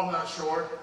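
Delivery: ordinary talk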